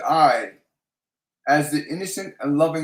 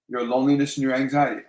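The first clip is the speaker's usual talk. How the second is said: ordinary talk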